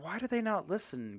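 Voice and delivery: high-pitched put-on boy question; Charlie. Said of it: "Charlie's like".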